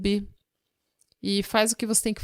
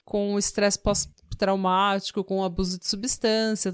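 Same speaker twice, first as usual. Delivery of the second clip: ordinary talk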